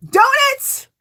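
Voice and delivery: thrilled at the fabric reveal; delighted gasp. Real, not acted. Showing viewers the skirt fabric, squealing with delight.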